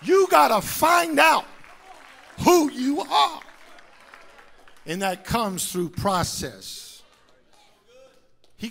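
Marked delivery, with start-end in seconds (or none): 0.0-3.4 full-force shouted preaching, rising. Acted. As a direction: drive it home to the congregation.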